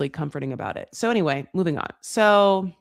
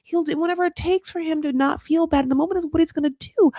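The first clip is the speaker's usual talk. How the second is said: mock retort; 7 semitones higher than usual. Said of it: "you're just like".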